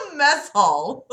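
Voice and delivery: high, slow, laughing repeat. Real, not acted. Reader cracking up at Lucifer's mess hall, laughing.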